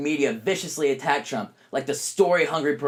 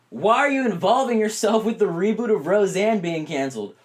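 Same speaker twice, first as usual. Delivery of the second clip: usual speaking voice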